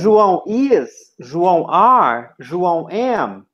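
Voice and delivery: IS, ARE and AM each stretched slowly and falling; drawn-out options. Shown as a demonstration. Teacher asking the class to pick a verb, drawn-out options. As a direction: slowly offer "is", "are", "am" as three choices.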